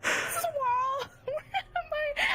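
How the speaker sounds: high mock-sobbing cry through laughter; laugh-crying shout. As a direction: wail it, half laughing.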